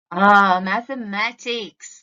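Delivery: normal talking voice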